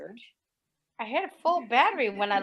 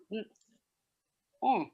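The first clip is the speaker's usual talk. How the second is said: ordinary talk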